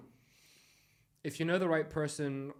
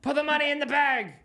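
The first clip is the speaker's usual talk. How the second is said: high, very loud hold-up demand; mock robbery bellow, pitched way up; bank robber's shout; 13 semitones higher, 12 dB louder than usual. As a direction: yell it at terrified bank tellers.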